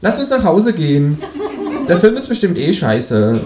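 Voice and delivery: high, loud talking animal; high-pitched, emphatic grumbling; dismissive kangaroo. Acted. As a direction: complain in a high creature voice. Kangaroo grumbles about film.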